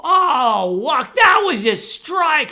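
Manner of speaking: loud, high outraged yell, drawn out; hammed-up sideline bellow; mad heckling parent. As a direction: holler at the umpire like a furious sports parent. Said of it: "She was getting so mad".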